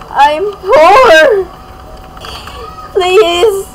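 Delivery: high, loud mock pleading; pleading beggar. Begging players in roleplay.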